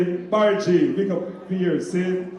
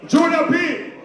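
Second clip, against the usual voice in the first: high, louder shout of the name; calling out; 9 semitones higher, 4 dB louder than usual. Real, not acted. MC calling the DJ to the turntables, high shout. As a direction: summon the DJ to the turntable.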